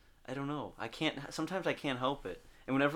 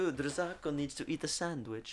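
higher-pitched put-on food-tease voice; voz burlona impostada; 4 semitones higher than usual. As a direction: coo it like a hammy caricature. Teasing in a put-on accent.

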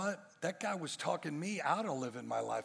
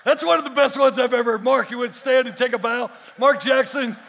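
very loud, high, excited announcing; rousing stage acclaim; 9 semitones higher, 15 dB louder than usual. Real, not acted. Preacher presenting Mark to the congregation for a bow, rousing shout. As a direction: hail him over the applause. Hails a member before the crowd.

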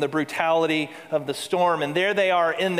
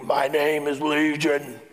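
demonic boast; 3 dB louder than usual. Acted. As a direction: boast like something out of a movie from the pits of hell. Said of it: "it's from the pits of hell".

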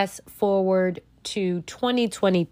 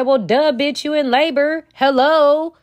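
sassy, louder mock nurse; higher, snotty nurse voice talking down; rude nurse; 6 semitones higher, 4 dB louder than usual. Nurse mocking the patient in labor, louder and sassy. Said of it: "The nurse looked at her and said".

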